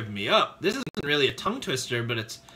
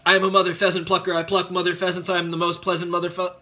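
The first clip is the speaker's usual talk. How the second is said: very fast tongue twister, tripping at the end; rapid-fire recital until it stumbles; faster; 2 semitones higher, much faster than usual. Shown as a demonstration. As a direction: race through it at double speed. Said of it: "let's try and say it faster".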